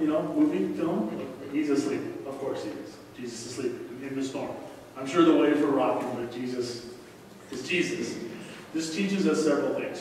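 ordinary talk

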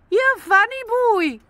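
usual speaking voice

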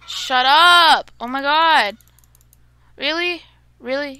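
loud outburst of disbelief; frustrated shout. Real, not acted. Gamer losing a chicken again, loud frustrated outburst. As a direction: blurt it out, fed up.

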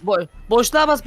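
usual speaking voice

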